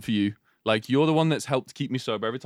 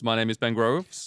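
ordinary talk

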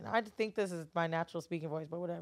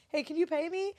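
high mock request voice; asking employee; 9 semitones higher than usual. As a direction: pipe up like a shy employee.

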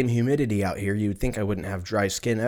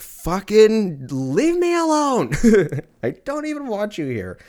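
fed-up parent; 11 semitones higher, 4 dB louder, slower than usual. Retold or imitated. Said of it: "parents like that who are just like".

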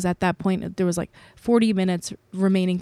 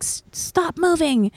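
higher, slow scolding parent voice; strict parent; 10 semitones higher, slower than usual. Retold or imitated. Imitating her parents scolding her as a kid, high and slow. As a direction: bark it like a stern parent. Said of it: "They'd be like".

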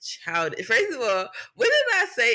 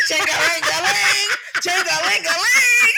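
loud, higher sing-song key jingle, building; jingling keys; 11 semitones higher, 5 dB louder than usual. Retold or imitated. Coworker retells scaring off the rats on the street, rising sing-song jingle.

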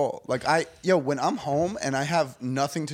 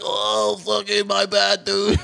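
slow, strained, higher groaning parody of illness; mock sick voice; 4 semitones higher, slower than usual. Shown as a demonstration. Mocking someone who just threw up, fake sick voice. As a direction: groan it out as if faking deathly sickness. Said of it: "I'm not gonna be like".

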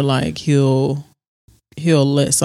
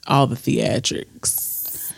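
whisper; 3 semitones lower, 6 dB quieter, much slower than usual. Real, not acted. Disdainful aside about DC.

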